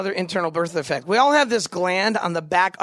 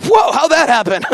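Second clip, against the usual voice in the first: louder mock whoa of surprise; 4 semitones higher, 8 dB louder than usual. Guys at the urinal, mock surprised louder whoa. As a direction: play a guy baffled by his own aim. Guy surprised at his aim.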